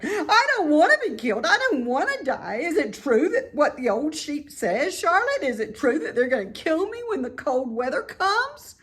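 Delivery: high, loud, fast panicked questions; frantic pleading. A narrator's reading. Wilbur frantically pleads with Charlotte, high and loud. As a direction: plead in a rush of fear.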